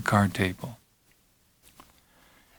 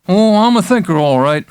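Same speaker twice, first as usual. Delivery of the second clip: high, loud, swaggering quip; patient joking with the doctor, brassy and loud; McMurphy, cocky; 7 semitones higher, 4 dB louder than usual. Acted. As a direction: toss it off with a grin.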